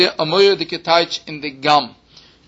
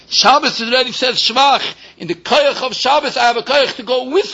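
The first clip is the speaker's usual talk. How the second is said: fervent preaching; 6 semitones higher than usual. Acted. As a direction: lift the voice and preach it hard. Rising to a high preaching pitch.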